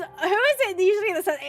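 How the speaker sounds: ordinary talk, nothing marked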